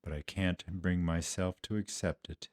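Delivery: low, soft, halting remorse; guilt-ridden confession. Acted. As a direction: admit it quietly, weighed down by guilt. Guilt-ridden disciple cannot accept forgiveness.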